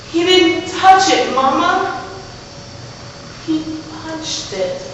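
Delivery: put-on pleading child. Child tries explaining to mama.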